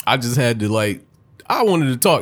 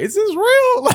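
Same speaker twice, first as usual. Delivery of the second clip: high, loud stunned question; disbelieving; 6 dB louder, slower than usual. Retold or imitated. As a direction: act floored by what you hear. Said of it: "high disbelief".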